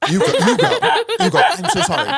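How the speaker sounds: hammed-up hesitant apology through laughter; mock apologetic. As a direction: play a reluctant fighter backing out, laughing. Acted fighter hesitating, apologising mid-scene.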